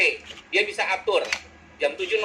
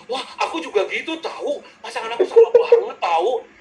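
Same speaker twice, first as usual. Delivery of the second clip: very high, over-excited squeal; orang E heboh; 12 semitones higher, 5 dB louder than usual. Acted. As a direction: gush in agreement like a hammed-up chatterbox. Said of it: "Kalau orang E gimana?". (heboh melengking ikut curhat, dilebih-lebihkan)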